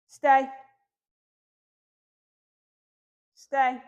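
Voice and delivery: loud, firm stay for the puppy; firm command. Real, not acted. Trainer firmly telling Tucker to stay. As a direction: plant the word, firm and loud. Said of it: "in a pretty firm tone".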